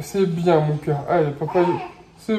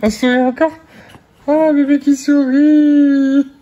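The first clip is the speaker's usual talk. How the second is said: higher, louder slow delighted coo; 5 semitones higher, 5 dB louder than usual. Real, not acted. Parent delighted by baby's smile, gushing. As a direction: gush over the smile.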